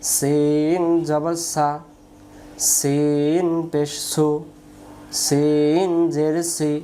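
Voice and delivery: সিন drawn out with a long hiss, each syllable slow; slow drilled reading. Shown as a demonstration. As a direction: chant the drill slowly for students. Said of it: "আবার". (শিক্ষার্থীদের জন্য ধীরে টেনে পড়া)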